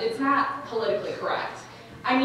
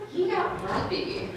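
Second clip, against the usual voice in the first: normal talking voice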